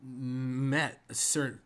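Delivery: put-on halting, stammering young guardsman; hesitant stop-and-start greeting; stuttering. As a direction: stumble and pause between words. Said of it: "if you'd stop stuttering".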